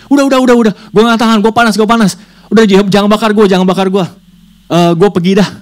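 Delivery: high, loud, desperate begging; pleading demon. Acted. Demon begging the boy to stop burning it, loud high plea. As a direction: beg like a burning spirit. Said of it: "paman ini bilang gini".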